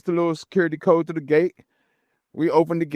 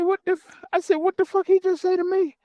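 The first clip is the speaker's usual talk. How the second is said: mock outrage. Narrator's indignant inner reaction.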